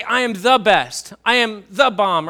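slow, loud boast punching "the"; emphatic claim leaning hard on "the". Shown as a demonstration. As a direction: stretch and hit "the" like a brag. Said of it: "you're making an emphatic statement".

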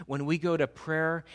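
ordinary delivery

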